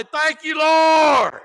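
high, loud cry of Thank you, Lord; joyful thanks. Shown as a demonstration. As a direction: shout your thanks up to heaven. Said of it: "get that cup of coffee, smile, say".

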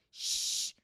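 sharp hissing shush; hissed shush. A narrator's reading. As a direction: hiss for silence. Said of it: "hissed Professor McGonagall".

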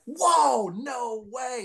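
high, thrilled gasp of disbelief; amazed whoa. Retold or imitated. Meeting the bench players, high amazed whoa. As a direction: sell the starstruck wonder. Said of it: "I was like".

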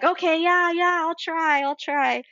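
high-pitched, repeated yeah-yeah assurance; retold placating agreement. Retold giving in to a suggestion.

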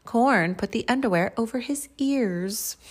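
ordinary delivery, nothing marked

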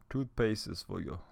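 amateur Jamaican imitation; bad Jamaican accent. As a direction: pass on wisdom in a shaky Jamaican accent. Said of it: "my Jamaican accent is fucking shitty".

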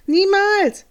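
high, loud shout of refusal; screaming sister. A narrator's reading. Sister screams at her friend on the phone. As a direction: scream the word out from next door. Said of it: "schreit seine Schwester".

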